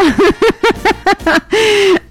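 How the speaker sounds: high laugh that falls away; real laughter. Real, not acted. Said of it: "Van a disculpar que yo me ría así".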